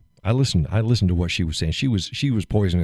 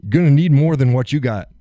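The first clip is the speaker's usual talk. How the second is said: much higher, defiant warning; defiant threat; 9 semitones higher, 4 dB louder than usual. Retold or imitated. Man defying the guards who want him out. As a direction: dare them to try it. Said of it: "I said".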